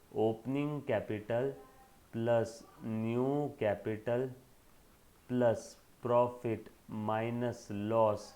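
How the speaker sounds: very slow, word-by-word recital; measured, deliberate reading-out for note-takers; slow dictation. Real, not acted. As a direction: dictate the formula with gaps between terms. Teacher dictating a formula.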